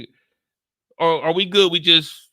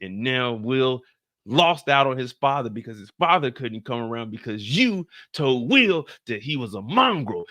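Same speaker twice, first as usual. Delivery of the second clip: ordinary talk, nothing marked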